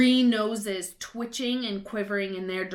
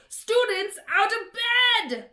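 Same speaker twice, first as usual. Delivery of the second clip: much higher, loud, slow bellow; 13 semitones higher, 7 dB louder, slower than usual. A narrator's reading. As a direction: yell to raise the alarm. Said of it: "Peeves bellowed".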